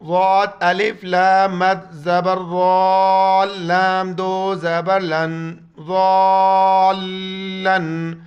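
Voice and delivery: loud, drawn-out teacher spelling; spelling drill. Shown as a demonstration. Teacher to students, loud spelling drill. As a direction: call each letter out to the class. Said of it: "پھر دیکھیں".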